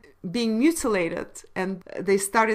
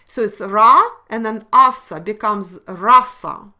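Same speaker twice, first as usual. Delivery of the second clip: normal talking voice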